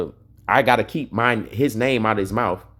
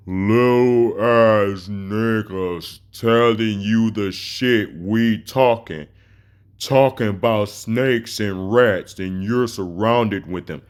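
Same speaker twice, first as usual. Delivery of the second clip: very slow, drawn-out mocking read; slow motion reading; slower than usual. Shown as a demonstration. Replaying the rival's words slowly to mock him. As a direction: drag each word out slowly. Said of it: "let's read that in slow motion".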